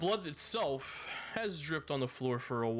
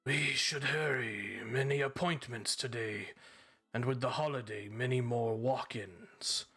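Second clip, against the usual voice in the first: snake voice; 2 semitones higher, 4 dB quieter than usual. Acted. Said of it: "You hear a hissing voice in your left ear".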